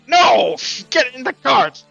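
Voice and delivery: loud, high, exasperated order; guard's shouted refusal. Acted. Guard refuses player's request.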